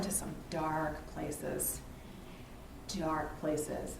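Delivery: soft, slow, half-whispered confession; retold hushed words. Friend confiding over dinner, softly retold hushed words. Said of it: "she just kind of whispered".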